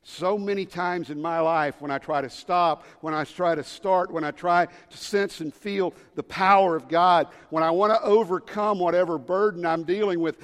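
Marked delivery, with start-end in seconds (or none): none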